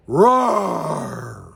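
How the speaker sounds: imitated lion roar. Shown as a demonstration. Lecturer showing how a lion goes, loud roar trailing off. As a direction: hit it hard, then let it die away. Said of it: "'Cause a lion goes".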